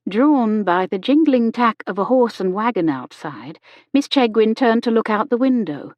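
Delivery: ordinary delivery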